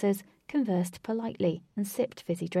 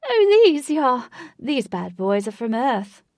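very loud, high posh exclamation, then settling; posh accent; 4 semitones higher, 10 dB louder than usual. A narrator's reading. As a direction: show off noisily in a posh accent. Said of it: "He said in a posh accent".

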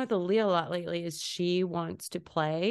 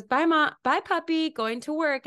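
put-on high, sing-out child's goodbye; pitched-up playful kid voice; imitated young daughter; 8 semitones higher, 3 dB louder than usual. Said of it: "She goes".

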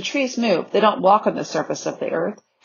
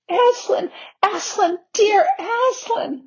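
much higher, overcome cry of the name; acted sobbing; 13 semitones higher, slower than usual. A narrator's reading. Lucy reaching the lion at last, sobbing with relief. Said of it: "sobbed Lucy".

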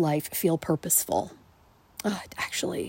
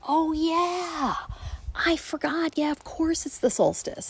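husband's reply; 11 semitones higher than usual. Retold or imitated. Husband voiced in retold chat.

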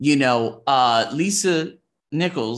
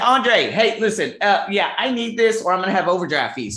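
higher, louder urgent asking; urgent plea; 7 semitones higher, 6 dB louder than usual. Retold or imitated. Begging Andre to cover overdraft, higher and louder. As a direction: press him hard for help. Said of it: "I said".

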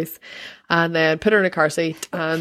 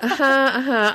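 higher-pitched, awkward little uh-huhs; awkward polite agreement; 6 semitones higher than usual. Retold or imitated. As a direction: play along awkwardly with a stranger. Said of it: "but outwardly, I just say".

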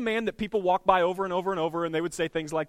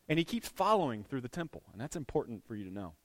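ordinary talk

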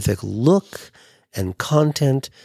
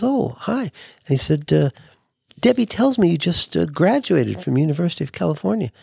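higher, polite host voice; imitating Hef; 7 semitones higher, faster than usual. Hef politely greeting Larry at the party, in a higher polite voice. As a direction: play the gracious host greeting a guest.

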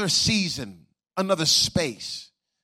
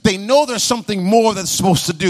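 rising, louder preaching over music; full-force preaching; 4 semitones higher, 4 dB louder than usual. Acted. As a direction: preach it home with mounting intensity.

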